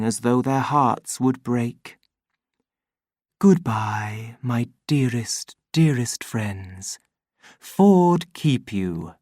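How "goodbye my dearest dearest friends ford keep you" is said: gentle voiced farewell; loving goodbye. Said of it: "loving goodbyes from the voice".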